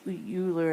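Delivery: Euler said "YOO-ler"; Euler rhyming with ruler; Euler as Yooler. Shown as a demonstration. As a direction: say Euler with an opening "you" sound. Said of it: "I know it looks like".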